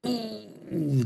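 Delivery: high, hushed sympathetic ooh; surprised crowd cry. A narrator's reading. Crowd watching Teebs collapse, a sympathetic high ooh. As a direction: wince along with the crowd. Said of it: "cried in surprise and sympathy".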